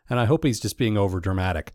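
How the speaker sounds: normal talking voice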